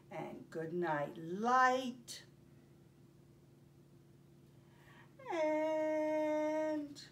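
gentle sing-song storybook reading; reading to children. Acted. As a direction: read it slowly for little listeners. Teacher reads picture book aloud.

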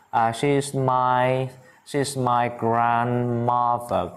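slow, stretched-out teaching delivery; slow model phrase. Shown as a demonstration. Teacher models "my grandmother" for students, very slowly. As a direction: spell it out word by word, slowly.

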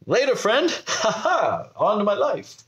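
a hammed-up carefree send-off; mock breezy goodbye. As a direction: wave it off with a fake laugh.

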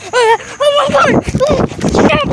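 very high panicked shout; terrified scream. Real, not acted. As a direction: scream in sudden fright. Sudden scare, shouting.

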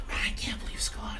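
hushed aside; whispering. Real, not acted. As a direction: whisper it off mic.

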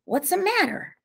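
high, wary question. A narrator's reading. Stacy questions the hurt T J, wary. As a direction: ask warily, pitched well up. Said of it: "his voice wary".

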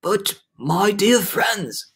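rally them with drawn-out cheer; put-on elderly optimism; trying to be cheerful. A narrator's reading. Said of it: "trying to be cheerful".

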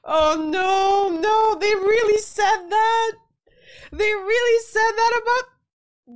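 high, wailing incredulity; appalled disbelief. Real, not acted. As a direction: cry out high at the awful irony. Appalled by the unsinkable claim.

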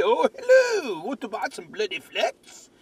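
high, hammed-up British hello; salesman's pitch in bad British, swooping high; fake British accent. Boss teasing colleague with sales pitch, fake British accent. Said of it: "fake British accent".

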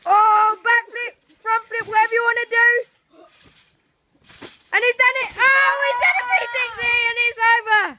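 loud hyped commentary; excited shouting. Real, not acted. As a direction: call it like a big win.

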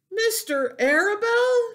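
high, loud shocked question; gritado, incrédulo: shouted disbelief. A narrator's reading. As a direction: shout it as a startled piglet. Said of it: "shouted Wilbur".

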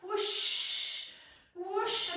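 breathy whoosh of wings; whoosh sound. Shown as a demonstration. Teacher giving a whoosh for kids flying their arms. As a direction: blow out a whooshing flight noise.

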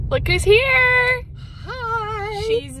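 high, loud and slow welcome; excited. Real, not acted. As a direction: greet her with delight. Greeting a friend who arrived.

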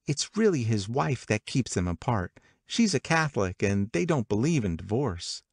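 ordinary delivery

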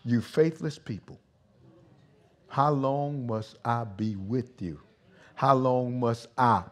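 mild, measured scolding; too nice. Acted. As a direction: read the rebuke slowly and nicely, without bite. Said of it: "see, y'all make him nice".

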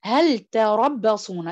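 "هل تربصون" slow, the لام of "هل" said clearly; clear idh-har recitation. Shown as a demonstration. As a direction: recite slowly, "هل" crisp before "تربصون". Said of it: "فبنقول". (تلاوة بطيئة مع إظهار اللام في "هل")

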